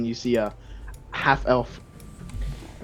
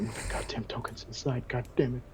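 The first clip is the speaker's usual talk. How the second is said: whispered, exasperated aside; whispered grumble about the tokens; muttered under breath; 10 dB quieter than usual. Real, not acted. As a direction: hiss it under your breath. GM hurrying players, under breath.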